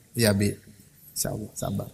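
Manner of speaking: quiet, low, yielding reply; patient wife. Retold or imitated. Wife agreeing to wait for her husband, hushed patient acceptance.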